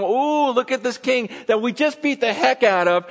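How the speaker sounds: high-pitched fake admiration; mocking. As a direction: gloat in mock awe. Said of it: "they're totally mocking him".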